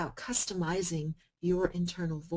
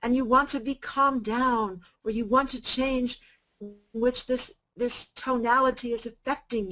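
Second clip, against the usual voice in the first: raised, loud, high-pitched voice; 6 semitones higher, 8 dB louder than usual. Shown as a demonstration. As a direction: turn the volume right up to demonstrate. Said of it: "in a very loud tone of voice".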